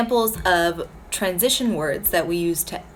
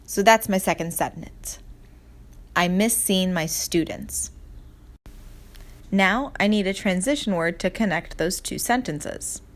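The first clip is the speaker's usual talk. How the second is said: normal talking voice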